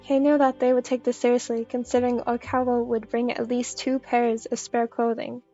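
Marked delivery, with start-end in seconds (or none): none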